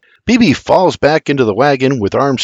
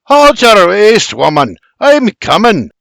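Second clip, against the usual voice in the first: exasperated shout; 8 semitones higher, 5 dB louder than usual. A narrator's reading. Man in the wagon answers Berta, exasperated shout. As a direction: shout back wearily at nagging. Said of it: "rolls his eyes and shouts".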